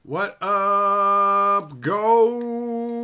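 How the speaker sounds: very slow, high, loud shout; drawn-out holler. Real, not acted. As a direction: holler it, stretching every vowel.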